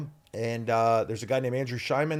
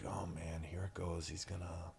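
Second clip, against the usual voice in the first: much softer, low inner groan of dread; 8 semitones lower, 16 dB quieter than usual. Retold or imitated. Young actor bracing for a scolding, soft dread. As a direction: mutter it to yourself, bracing for trouble. Retelling his own dread as kid.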